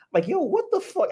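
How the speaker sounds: sudden high squeal of disbelief; stunned disbelief. Retold or imitated. Viewer reacting to Vanilla Sky, shocked high yelp. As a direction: yelp it like the movie just broke your brain. Recalling shock at weird movie.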